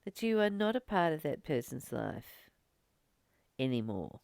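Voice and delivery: gentle, unhurried spiritual talk. Acted. Guided reflection to viewers.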